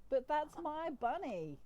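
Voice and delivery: put-on high, possessive child's plea; whining kid protest. Alien protests losing his bunny.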